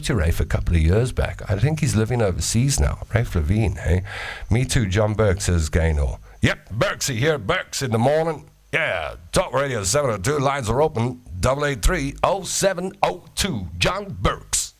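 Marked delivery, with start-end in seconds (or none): 6.4-14.7 higher, upbeat morning radio DJ. Retold or imitated. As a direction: plug the show like a breakfast DJ. Imitating radio DJ John Burks.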